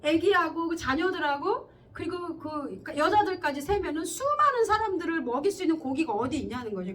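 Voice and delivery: normal talking voice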